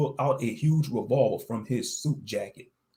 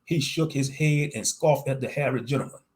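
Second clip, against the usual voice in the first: plain reading voice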